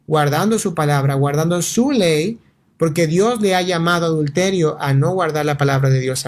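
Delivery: ordinary delivery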